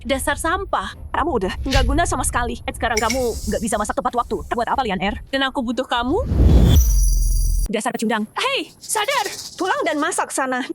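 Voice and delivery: shrill, high, nagging scold; scolding mother. Acted. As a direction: berate him like a harsh mother-in-law. Mother berates him.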